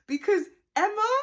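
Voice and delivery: Emma called out high and loud; excited exclamation. Real, not acted. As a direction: burst out with the name Emma. Worked up about Emma's fight.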